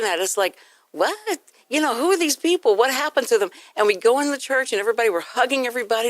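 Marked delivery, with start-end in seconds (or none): 0.9-3.5 high-pitched incredulous questioning; imitated baffled kids. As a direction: voice bewildered children in mock disbelief. Kids baffled by parent's sudden cheer.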